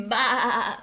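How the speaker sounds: loud bleated bah; loud sheep's bleat. A narrator's reading. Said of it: "bleated Sheep".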